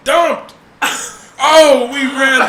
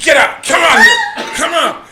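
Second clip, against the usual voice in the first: loud barking commands, the middle one jumping high; mock angry yell; 7 semitones higher, 4 dB louder than usual. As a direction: bellow them out of hiding. Father yelling into closet.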